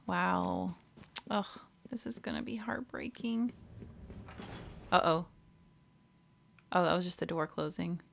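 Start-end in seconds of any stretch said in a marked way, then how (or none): 1.9-3.5 whispered dread. Real, not acted. Player braces for a sad scene, whispering. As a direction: breathe it out, bracing for sadness.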